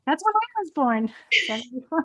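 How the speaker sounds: high, loud, laughing amazement; delighted surprise. Real, not acted. Guest learns they share a birth year, delighted surprise. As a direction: exclaim it in real, giddy surprise.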